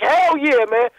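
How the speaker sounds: high excited hell yeah; hyped agreement. Retold or imitated. Caller retells agreeing with Q, high excited cheer. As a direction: cheer it out, pitch jumping up. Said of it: "I'm like".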